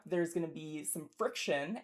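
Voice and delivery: usual speaking voice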